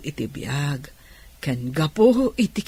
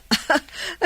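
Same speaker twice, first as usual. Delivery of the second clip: laughing; 9 semitones higher than usual. Acted. Laughs at the invitation.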